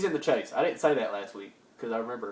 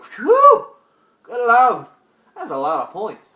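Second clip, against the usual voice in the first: high loud whew, settling down; shocked exclamation; 11 semitones higher, 16 dB louder, slower than usual. Retold or imitated. Vlogger reacting to points allowed, loud astonished whew. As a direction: react like the number floored you. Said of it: "I was like".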